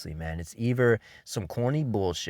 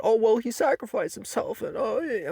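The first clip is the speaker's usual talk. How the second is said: mocking fan excuse; 12 semitones higher than usual.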